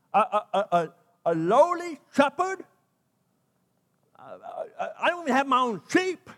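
high, stammering, incredulous Moses; a shepherd told to face Pharaoh, stammering in shock; stunned disbelief. Acted. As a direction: splutter like you can't believe the order.

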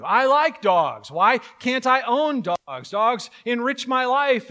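high, loud, slow protest; an outraged dog lover, high and loud; pleading argument. Acted. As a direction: plead your case loudly, drawn out. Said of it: "somebody wants to argue".

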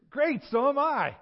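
high, loud, drawn-out delight; acted over-eager enthusiasm; thrilled agreement. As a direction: exclaim it like a joyful discovery. Preacher thrilled at shared faith.

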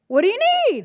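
surprised. Retold or imitated. Asking the boy.

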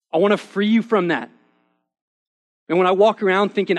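fervent gospel proclamation; full-force preaching. Acted. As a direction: drive every phrase home to the congregation.

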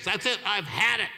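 fed-up commanding prayer, acted out; angry rebuke. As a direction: cast it out firmly, retelling your fed-up moment. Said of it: "I got angry".

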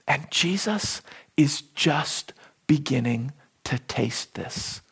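hushed. Real, not acted. Sombre climax of point.

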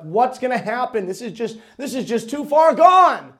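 building to a loud, high cry of despair; exasperated, despairing outburst; hopeless protest. Retold or imitated. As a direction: imitate someone upset and losing hope. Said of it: "he almost seems hopeless".

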